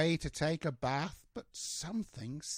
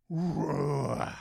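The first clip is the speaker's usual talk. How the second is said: loud, growling engine roar; louder playful engine roar; 6 dB louder than usual. Acted. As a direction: roar like a big truck engine. Engines rev in story.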